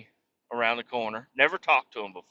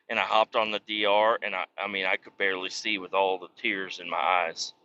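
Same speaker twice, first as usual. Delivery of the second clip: ordinary talk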